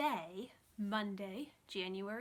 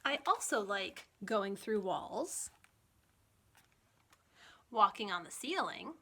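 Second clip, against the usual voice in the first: put-on reading to children; 5 dB louder, slower than usual. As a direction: read it like a children's librarian holding a picture book up to the kids. Reads picture book to kids.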